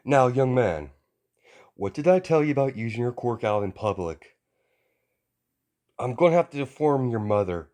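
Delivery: put-on a booming hero lecturing a kid; stern hero voice. All Might scolds young Izuku, loud and high.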